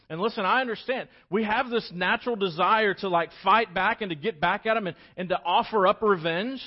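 high, loud preacher's build; rising sermon fervour; impassioned preaching. Acted. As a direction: drive it home to the congregation. Preacher building to full force.

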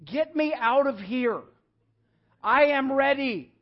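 put-on high, loud, slow toddler demand; child's plea. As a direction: plead like a desperate little kid. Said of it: "That's her way of saying".

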